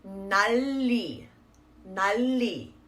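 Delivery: 날리 as nal-li, L sounded twice; 날리 run together with a long L; wrong doubled L. Shown as a demonstration. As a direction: hold the L across both syllables of 날리. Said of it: "not pronouncing that L twice".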